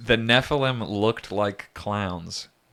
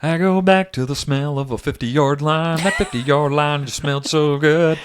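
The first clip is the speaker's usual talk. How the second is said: country ballad croon, raised and louder; country singer voice; 6 semitones higher, 6 dB louder than usual. Pretend. Parodies [name] song lyrics.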